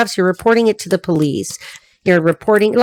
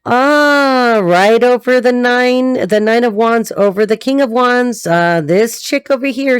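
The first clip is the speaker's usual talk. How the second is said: ordinary talk, nothing marked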